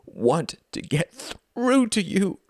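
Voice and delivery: high, slow, desperate plea; pleading at a strained high pitch; supplication. A narrator's reading. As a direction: beg to be heard, drawing it out. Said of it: "hands in bottomless supplication".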